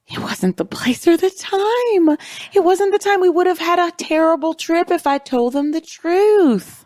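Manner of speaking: high-pitched imitation of a testimonial; retold plea, pitched well up and drawn out; mock-earnest high voice, stretching the key words; Cameron. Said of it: "to which Cameron says".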